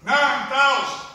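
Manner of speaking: slow, loud, high NINE THOUSAND; emphatic repetition. Real, not acted. Speaker driving home battle losses to the congregation, emphatic. Repeats casualty figure for weight.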